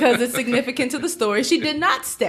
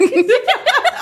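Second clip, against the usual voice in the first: laughing; 5 dB louder than usual. Real, not acted. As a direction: burst of high laughter. Laughs while mock pleading.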